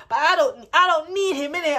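hyped self-talk. Retold or imitated. Boasting to herself about her ex, high and loud. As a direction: pump yourself up, high and loud.

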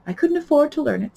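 plain reading voice

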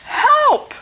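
very high, loud cry for help; storybook yell, shooting right up high; panicked shout. A narrator's reading. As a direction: shout it out at a squealing pitch. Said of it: "Miguel shouted".

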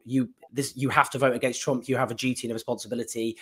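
usual speaking voice

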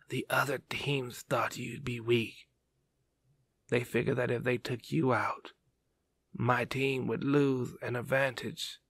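faint, fading dying voice; weak whisper. Acted. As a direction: speak softly, with little breath. Dying man recalls battle softly.

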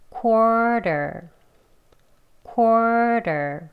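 "quarter" with a strong, long first syllable; first-syllable stress. Shown as a demonstration. Teacher repeating for learners, "quarter" stressed long on the first syllable. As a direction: lean hard and long on the start of "quarter". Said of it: "강하게 높게 길게 첫 번째 음절 합니다".

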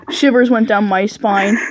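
plain reading voice

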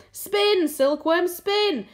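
high, loud, driving shouted orders; frantic shouting. A narrator's reading. Centipede exhorting the spinners from below, frantic shouting. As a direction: shout it frantically, pitched well up.

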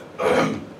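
small throat clear for attention. Retold or imitated. Self-styled alpha male about to interrupt someone, throat clearing. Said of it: "in the most alpha male possible way".

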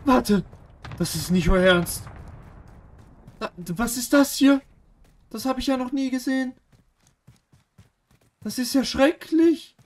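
high, appalled put-on voice; shocked falsetto. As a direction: squeal in mock horror at the map. Entsetzt über die Map, hohe Stimme. (hohe, entsetzte Quietschstimme)

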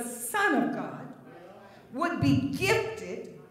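high-pitched, measured scripture declamation; preaching. Real, not acted. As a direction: proclaim it phrase by phrase to the congregation.

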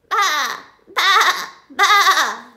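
loud, high bleats; hen faking baa. A narrator's reading. Hen tricking the thieves, loud bleating. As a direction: bleat three times like a sheep. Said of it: "said the fat red hen".

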